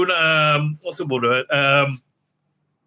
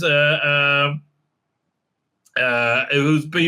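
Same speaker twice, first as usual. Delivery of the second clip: ordinary talk, nothing marked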